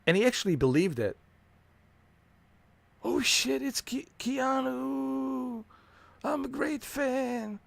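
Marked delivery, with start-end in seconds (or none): 3.0-7.6 much higher, slow, squealing star-struck fan; starstruck fan falsetto. Retold or imitated. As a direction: squeal like a fan meeting his idol. Brother mimicked, high falsetto.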